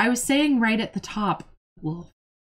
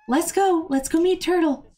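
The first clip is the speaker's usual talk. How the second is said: coaxing pet talk; 8 semitones higher than usual. Real, not acted. Coaxing pet to meet turtle.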